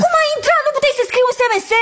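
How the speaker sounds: high, rapid scolding yell. Acted. Woman scolds friend who walked in unannounced, yelling. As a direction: scold the intruder at a high pitch. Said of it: "nu mai țipa așa".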